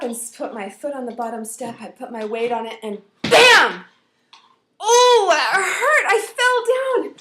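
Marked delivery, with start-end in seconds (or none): 3.2-3.8 explosive shouted bam; loud impact noise. Retold or imitated. Sudden fall in story. 4.8-7.1 high, loud, drawn-out wail; acted pain cry. Child hurt by a fall.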